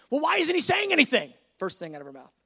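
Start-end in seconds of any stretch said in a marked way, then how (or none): 0.1-1.3 indignant. Retold or imitated. Clerk demands an answer.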